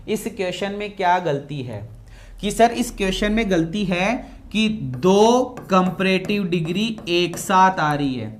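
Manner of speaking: ordinary delivery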